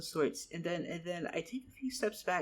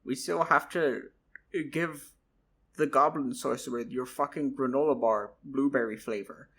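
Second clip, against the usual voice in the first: louder, firm correction; blunt retort; 6 dB louder than usual. Acted. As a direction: cut him off, loud and blunt. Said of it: "Charles was like".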